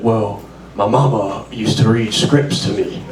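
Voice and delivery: a quick [name] accent impression. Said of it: "he's still doing the [name] accent".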